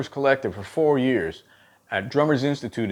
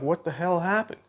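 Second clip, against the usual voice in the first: baffled teacher; 6 semitones higher, 4 dB quieter than usual. Retold or imitated. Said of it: "I'm like".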